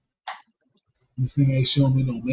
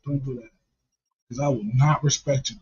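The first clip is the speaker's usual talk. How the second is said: ordinary talk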